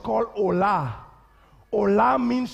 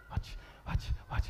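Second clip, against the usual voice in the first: hushed whisper; 13 dB quieter than usual. Real, not acted. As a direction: drop to a whisper. Preacher hushes crowd.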